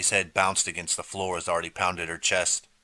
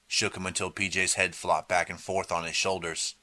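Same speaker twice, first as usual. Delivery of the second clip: ordinary delivery, nothing marked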